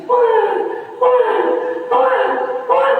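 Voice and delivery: high wailing baby cry, acted; high mock infant wail. Baby cries as mother holds it.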